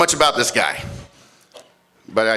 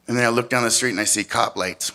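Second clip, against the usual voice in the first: ordinary talk, nothing marked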